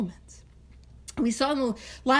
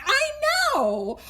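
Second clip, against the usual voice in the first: drawn-out, higher knowing sigh; mock knowing group; slower than usual. Imitating members in the meeting, slow and high. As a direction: play the weary know-it-all, slowly. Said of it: "some of you are like".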